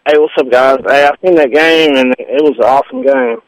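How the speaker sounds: ordinary talk, nothing marked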